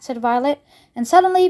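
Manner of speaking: ordinary delivery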